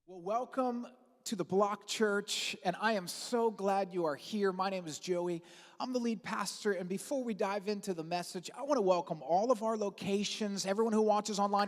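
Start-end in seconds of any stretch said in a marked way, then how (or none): none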